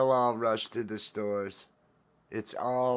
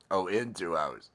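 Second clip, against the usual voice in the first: ordinary delivery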